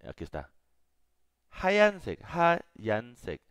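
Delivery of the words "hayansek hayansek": Hayansek with a breathy h, ha-yan-sek; teacher says Hayansek, first take loud and high; model pronunciation. Shown as a demonstration. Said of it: "Aquí vamos a poner".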